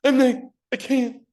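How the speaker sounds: high-pitched, anguished refusal; hammed-up voice of inner doubt; protesting subconscious. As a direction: wail it as mock self-doubt. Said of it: "Subconscious says".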